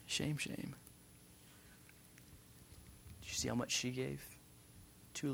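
very soft, murmured tutting remark; hushed disapproval. Acted. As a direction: shame her under your breath. Voicing crowd's muted disapproval.

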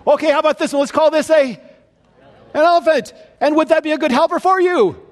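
hammed-up, teasing God showing off his creatures; playful God voice.

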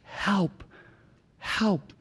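a quiet desperate cry for help; soft plea to God. Retold or imitated. Pastor voicing his fasting prayer, soft and pleading. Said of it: "and say".

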